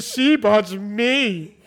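very slow, drawn-out mock plea; mimicking his friend. Teasing his friend by imitating him, slow drawn mock plea. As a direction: drag it out like a whining defendant. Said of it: "He said".

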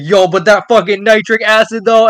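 hyped shout. Retold or imitated. Doctor Stone hyping up nitric acid, a loud excited shout. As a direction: yell it like you just saw something amazing. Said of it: "And he's like".

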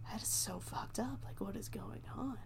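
hushed whisper, imitating stunned onlookers. Deputies staring at the attacked Santa, stunned whisper. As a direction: whisper it in mock disbelief.

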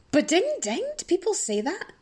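high-pitched, puzzled double-check; incredulous questioning. Real, not acted. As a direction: pitch leaps up in disbelief. Doubting own sound effect.

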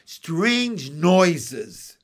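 loud, taunting frog; teasing raspy croaker; raspy bullfrog. A narrator's reading. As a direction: relish the clue with a rasp. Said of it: "in his raspy voice".

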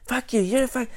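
high-pitched cartoonish outrage; mock angry sputter. Customer insulting the worker on his way out, high mock outrage. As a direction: imitate an enraged customer spitting insults.

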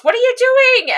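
high, loud, frantic question; hammed-up panicked shout; imitated yell. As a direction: yell it in alarm like the character. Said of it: "Ed yells".

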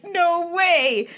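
high, loud, drawn disbelief; amazed exclamation. Retold or imitated. Telling the cohost her reaction to the recording, amazed. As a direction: cry out in astonishment.

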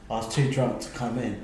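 ordinary talk, nothing marked

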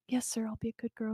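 very soft, quick, meek quoted reply; obedient heroine. Retold or imitated. Heroine answering Mister Tanner in the retelling, soft and obedient. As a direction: play her small and submissive, hurrying the answer. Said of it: "And she".

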